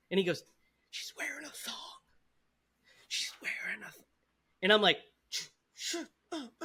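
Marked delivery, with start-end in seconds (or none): 0.9-4.0 breathy unvoiced whisper, much softer; a classmate's giggly whisper across the room. 5.3-6.5 high, soft, strained hush; caught-out boy begging his friend to hush; panicked shush. Retold or imitated.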